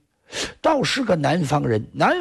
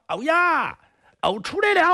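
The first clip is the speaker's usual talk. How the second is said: high, sing-song reply; high lilting answer; disguised Kong Xiu; 12 semitones higher than usual. Acted.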